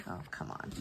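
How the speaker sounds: a voiceless, exasperated mutter; muttered frustration. Real, not acted. Planner vlogger annoyed at a slipping sticker, muttering. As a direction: grumble it under your breath at the mishap.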